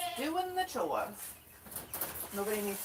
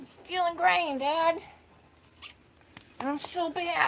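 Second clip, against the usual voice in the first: louder, playful voice for the goat confessing; cheeky goat voice; 6 dB louder than usual. Pretend. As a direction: speak as a proud little thief goat, loud and teasing. Voicing the naughty goat Max.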